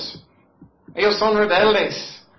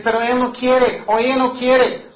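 a mildly raised, complaining voice of a listener; whiny objector; 6 semitones higher, 6 dB louder than usual. Retold or imitated. Preacher mimics a listener's excuse, mildly whiny. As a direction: mimic the doubter's excuse with a touch of whine. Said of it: "Y tú dices".

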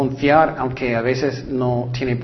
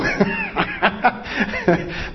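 high laugh; 4 dB quieter than usual. Real, not acted. Laughs after pastor quote.

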